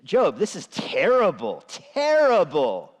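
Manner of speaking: slow, loud, drawn-out lament; hammed-up mourning friend; mock-grieving friends of Job. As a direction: wail it like a dramatic comforter. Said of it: "Job's friends come to him and say".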